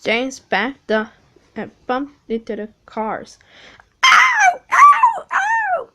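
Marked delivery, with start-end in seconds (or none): 4.0-5.9 shrill, very high loud yelps; screaming. Acted. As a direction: trucks yelping in pain. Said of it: "they screamed".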